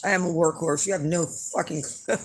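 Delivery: normal talking voice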